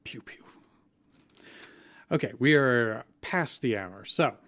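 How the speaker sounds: normal talking voice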